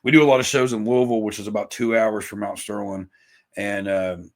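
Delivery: ordinary talk, nothing marked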